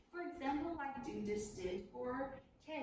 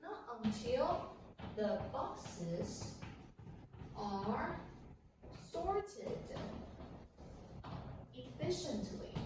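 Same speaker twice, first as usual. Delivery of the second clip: slow, each word separated by pauses; teacher reading the inverted sentence slowly; slow dictation; much slower than usual. Shown as a demonstration. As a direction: read the sentence out slowly for copying. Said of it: "put event B first".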